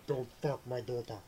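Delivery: a hint of Bruce Willis, unbothered; Bruce Willis impression. Bruce Willis warning men off his daughter, lightly voiced. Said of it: "That's what Bruce Willis sounds like".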